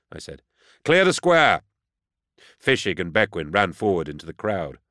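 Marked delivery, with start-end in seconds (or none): 0.8-1.6 loud, high-pitched command; shouted order. A narrator's reading. Inquisitor ordering the crowd out before a landing, shouting. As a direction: shout it across a crowded square.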